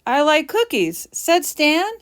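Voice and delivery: odd wrong reading. Shown as a demonstration. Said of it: "that doesn't sound right".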